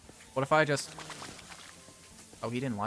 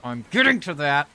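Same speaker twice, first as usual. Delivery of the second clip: loud defensive outburst; harried shout under complaints; exasperated yell; 2 semitones higher, 8 dB louder than usual. Acted. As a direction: snap back loudly, voice jumping up. Snapping at complaints about dirty pool.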